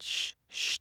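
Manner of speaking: soft hushing whisper. Retold or imitated. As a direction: shush her under your breath. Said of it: "I whispered".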